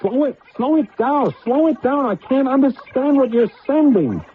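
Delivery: high, frantic pleading; panicked. Acted. Panicked man pleading with failing computer.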